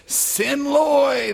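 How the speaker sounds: high, loud, exultant shout; yelled. A narrator's reading. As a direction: hurl it into the wind. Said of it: "they yelled".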